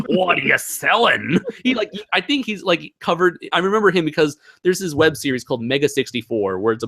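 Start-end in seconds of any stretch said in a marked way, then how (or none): 0.1-1.4 slow, higher put-on Cockney; hammed-up Cockney trader's question; Cockney merchant. Host imitates Resident Evil merchant, put-on Cockney. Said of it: "the Cockney accent who's like".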